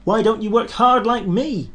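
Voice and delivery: high, loud teasing; cheeky engine voice. Acted. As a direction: taunt the sleepy big engine. Thomas teasing Gordon.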